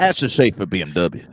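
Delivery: lightly imitated Southern dandy accent; car dealer pitch in mock Southern accent; Ashley Schaefer. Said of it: "Will Ferrell nailed that accent".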